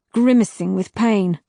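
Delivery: plain reading voice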